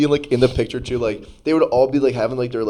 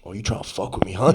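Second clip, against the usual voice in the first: lightly voiced tough granny; 5 semitones lower, faster than usual. Granny turning on the man who tested her, lightly voiced low threat. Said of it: "a deep bass in her voice".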